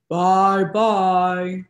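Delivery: big Papa Bear voice. Shown as a demonstration. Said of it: "big, deep voice like Papa Bear".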